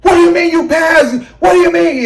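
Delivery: high-pitched, shouted disbelief; hysterical. Retold or imitated. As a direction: act out hysterically demanding an explanation. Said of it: "I was hysterical".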